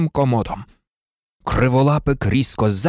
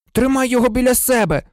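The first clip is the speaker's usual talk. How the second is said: irritated Ron; 9 semitones higher, faster than usual. A narrator's reading. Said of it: "роздратовано буркнув Рон".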